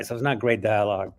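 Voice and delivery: ordinary talk, nothing marked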